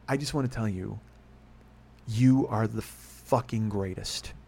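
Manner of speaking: slow, spaced-out delivery with pauses; deliberate, emphatic praise. Retold or imitated. Film producer praising the podcaster, slow and emphatic. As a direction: let each word of the compliment land slowly.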